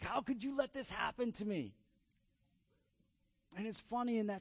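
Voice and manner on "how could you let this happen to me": mock scream. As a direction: imitate a child screaming in accusation, held back. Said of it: "just screaming".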